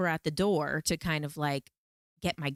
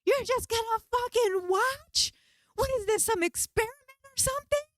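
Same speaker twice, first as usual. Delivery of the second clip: screaming at aliens. Retold or imitated. Yelling at friends who look like aliens, high furious screams. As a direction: scream at them for not helping. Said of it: "And I'm screaming at them".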